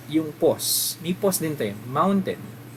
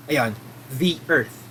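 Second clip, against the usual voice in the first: "the" stretched long as thee, slowly, before "earth"; emphatic thee; 2 semitones lower, slower than usual. Shown as a demonstration. Teacher shows the emphatic thee before earth. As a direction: lean on "the" to mean the only one. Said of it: "gusto mo lang lagyan ng emphasis".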